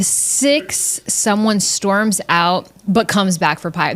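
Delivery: normal talking voice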